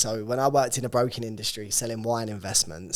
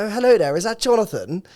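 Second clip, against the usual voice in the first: high, loud put-on posh English accent; mock posh English phone greeting; overdone posh Queen's English; 9 semitones higher, 7 dB louder than usual. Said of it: "they tried to teach us Queen's English posh".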